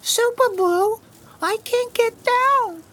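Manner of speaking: high small chick calling for help; little chick voice. Acted. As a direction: plead like a tiny stuck chick.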